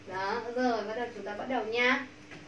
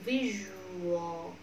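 "Visual" slowly pulled apart, lower pitch at the end; syllables stretched; 3 semitones lower, 4 dB quieter than usual. Shown as a demonstration. Teacher splitting the word so learners feel it, slow syllables. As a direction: draw out "Visual" part by part. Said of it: "mình tách ra cho các bạn cảm nhận dễ".

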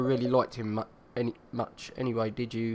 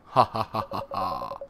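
Emperor voice; 4 semitones lower than usual. Acted. Emperor mocking the visitors' story, deep imperial laughter. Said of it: "I'll do that in my Emperor voice".

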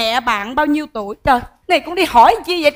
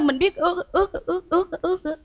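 soft, high, repeated stutter on one word; stuck stammering; 5 semitones higher, 6 dB quieter than usual. Acted. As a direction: stall helplessly, repeating the word in little bursts. Stuck for an answer, stalls.

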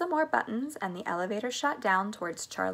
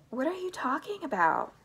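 higher-pitched, baffled question; bewildered question; 7 semitones higher than usual. A narrator's reading. Missus Bucket answers Charlie's babble, bewildered. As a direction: ask it high, thoroughly puzzled.

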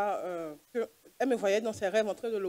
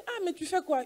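startled, as if catching someone mid-act; retelling her alarm at the anesthetist; alarmed question; 10 semitones higher than usual. Retold or imitated. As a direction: pitch jumps up high in shock. Doctor alarmed at cardiac massage.

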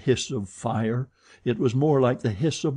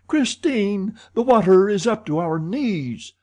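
high, urgent raised voice; crying out; 10 semitones higher, 5 dB louder than usual. A narrator's reading. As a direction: call out in alarm over rising water. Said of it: "cried Monsieur de Chagny".

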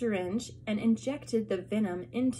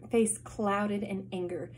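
ordinary delivery, nothing marked

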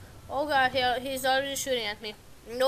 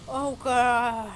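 ordinary talk

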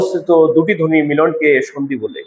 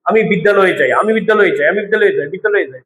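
quick repetition; faster than usual. Shown as a demonstration. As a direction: rattle off the joined বিদ্যালয়ে repeatedly. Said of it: "দ্রুত যখন আমি বলি". (দ্রুত, বারবার একটানা উচ্চারণ)

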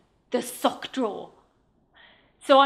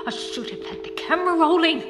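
quick, higher-pitched lament; anguished regret; 4 semitones higher, faster than usual. Acted. Woman lamenting she never filmed the priest, anguished regret. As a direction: let the regret rise up and burst out. Regret again, now more worked up.

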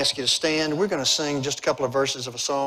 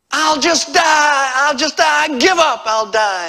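acted high, loud cry of surrender; despairing scream; 12 semitones higher, 10 dB louder, slower than usual. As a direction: scream it hopelessly, easing off at the very end. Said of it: "he just screamed out".

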